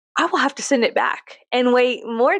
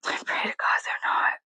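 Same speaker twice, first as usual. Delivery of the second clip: hushed, breathy aside; whisper; 6 dB quieter than usual. Real, not acted. Whispering an aside to viewers so nobody hears. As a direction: whisper it like a secret. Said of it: "in case this person is watching".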